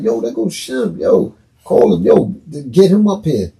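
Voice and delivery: excited calling. Retold or imitated. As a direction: wave him up through the crowd.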